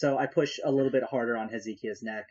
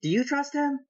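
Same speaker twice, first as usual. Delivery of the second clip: very high, earnest character voice; questioning Twilight; 4 dB louder than usual. Acted. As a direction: ask it pointedly in a high little voice.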